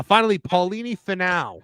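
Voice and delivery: ordinary delivery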